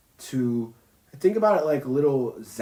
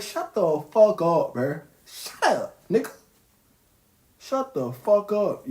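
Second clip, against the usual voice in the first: mock shout; 5 semitones higher than usual. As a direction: cut them off, hammed-up annoyance. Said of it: "I'm like".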